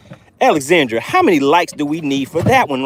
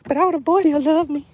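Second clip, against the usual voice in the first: high, put-on voice of a big fish; talking fish; 11 semitones higher than usual. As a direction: pipe up as the proud fish. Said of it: "She's like".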